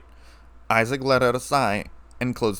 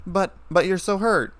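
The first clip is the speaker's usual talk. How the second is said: a touch of crying, pitched higher; mild tearful; 6 semitones higher than usual. A narrator's reading. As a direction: stammer it out, lightly upset. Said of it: "she said as she cried".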